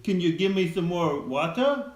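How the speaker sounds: put-on a quick try at an Australian accent. Dad tries a lightly done Australian accent for the viewers. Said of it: "Can you do an Australian accent?".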